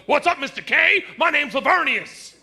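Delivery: loud, high-pitched greeting; swaggering boyfriend. Acted. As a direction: introduce yourself to dad, big and loud. Imagined boyfriend introduces himself.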